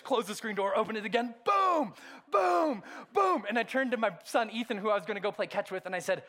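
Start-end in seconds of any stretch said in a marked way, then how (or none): 1.5-3.4 high shouted booms of frustration; retold shouting. Preacher reenacting slamming the screen door, high shouted booms. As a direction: slam each boom out loud and high.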